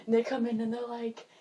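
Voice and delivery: ordinary talk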